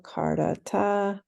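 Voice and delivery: slow "Kardata" with all three syllables clear. Shown as a demonstration. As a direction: stretch "Kardata" out deliberately. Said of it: "slow it down and stretch it out".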